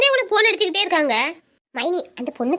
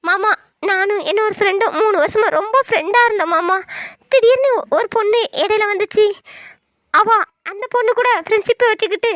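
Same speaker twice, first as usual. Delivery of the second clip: put-on higher-pitched, hurt young girl pouring out her troubles; tearful young niece; 3 semitones higher than usual. Niece confiding her friend troubles to her uncle, higher tearful voice. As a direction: play her as a wounded teen close to tears.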